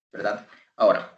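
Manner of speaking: ordinary talk, nothing marked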